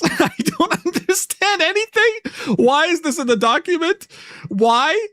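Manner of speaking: high, slow, laughing through words; laughing disbelief. Real, not acted. Streamer reacting to the document, laughing in disbelief. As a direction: crack up at how absurd it is.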